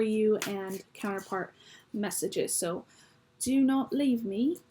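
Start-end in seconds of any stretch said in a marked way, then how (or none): 3.4-4.6 drawn-out, higher-pitched stab at British; amateur British accent, slow and high; attempted British accent. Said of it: "attempt at an accent".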